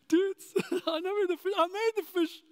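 high, laughing teasing voice; playful Jesus laughing through the line; giggling Jesus. Retold or imitated. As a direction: chuckle it out like you know the secret.

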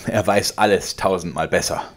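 laut und höher gerufen. A narrator's reading. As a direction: triumphierend hinausrufen. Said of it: "rief er". (der Vater ruft es Georg triumphierend zu)

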